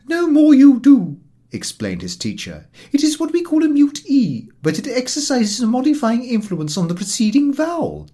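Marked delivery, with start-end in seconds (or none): 0.1-1.2 high, loud and prim; prim lady teacher. A narrator's reading. English lady correcting her French pupil, primly. 2.9-8.1 high, loud and prim; prim lady teacher. A narrator's reading. English lady correcting her French pupil, primly.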